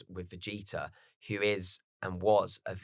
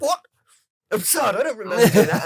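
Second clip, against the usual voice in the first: higher, louder denial with a laugh; flustered mock Vegeta; 11 semitones higher, 10 dB louder, faster than usual. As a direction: deny it, embarrassed, half laughing. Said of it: "And he goes".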